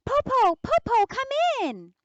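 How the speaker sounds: put-on high, loud children's shout; little sisters shouting to welcome their Popo, high and loud; excited shout. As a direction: call gleefully to let her in. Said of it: "They shouted".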